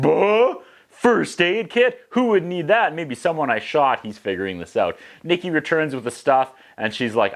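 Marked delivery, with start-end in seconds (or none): 0.0-4.0 high, drawn-out fake confusion; hammed-up innocent uncle; mock innocent. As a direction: play dumb in a squeaky voice. Unc voiced, playing dumb.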